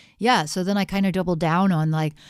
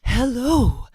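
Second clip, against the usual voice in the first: high, slow, put-on singer's speaking voice, a bit louder; mock singer speaking voice; 6 semitones higher, 4 dB louder, much slower than usual. Mocking singers, a high, slow put-on voice. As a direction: talk in an overdone singer's voice. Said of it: "nobody talks like that".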